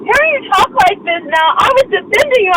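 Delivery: high-pitched, wounded protest; upset reproach. Real, not acted. Woman who was defending him, now upset and reproachful.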